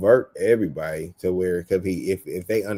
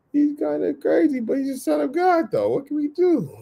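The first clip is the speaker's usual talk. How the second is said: pitched way up, bemused and worried; high mock disciple voice. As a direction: play the puzzled disciples in a squeaky voice. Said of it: "they're probably like".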